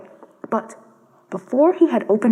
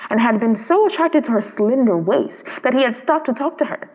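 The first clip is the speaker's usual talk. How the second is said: plain reading voice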